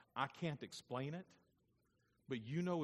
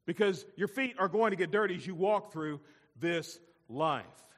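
loud, raised pulpit proclamation; impassioned preaching; 9 semitones higher, 14 dB louder than usual. Acted. Preacher voicing Jesus teaching Peter about washing, loud and forceful. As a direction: proclaim it to Peter with full conviction.

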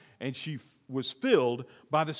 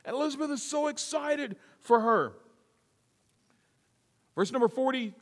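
ordinary talk, nothing marked

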